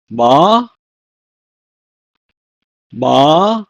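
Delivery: ma drawn out with a rising tune; Hebrew pronunciation. Shown as a demonstration. Teacher gives the Hebrew for what, long rising ma. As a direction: lift and stretch "ma", then repeat. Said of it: "is pronounced as".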